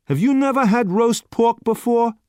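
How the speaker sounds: can't believe it; incredulous waiter. A narrator's reading. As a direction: waiter staring at the naive youth.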